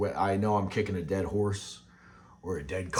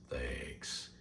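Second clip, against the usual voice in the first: a tiny breathy "Thanks" under the breath; mock whispered thanks; 9 semitones lower, 13 dB quieter than usual.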